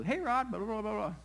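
high mock-Aussie hail; Australian accent. Famous surfer greeting the preacher out of the blue, mock Australian accent. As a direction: imitate a chatty Australian greeting. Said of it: "in his Australian accent".

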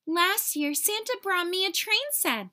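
put-on high, proud boy boasting of his gift; lightly voiced proud boy; boy bragging. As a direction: show off the train set. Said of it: "said Tom".

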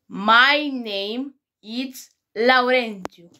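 foarte rar, silabisit apăsat; dictează „My name is Laurențiu” foarte rar; rar, de profesor. Shown as a demonstration. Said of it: "deci repetă după mine". (rar și apăsat, ca un profesor)

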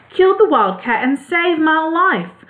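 high little mouse queen voice; high-pitched, as a tiny queen telling her subjects. A narrator's reading. Mouse queen tells subjects of her rescue, high-voiced.

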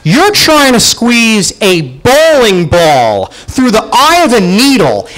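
normal talking voice

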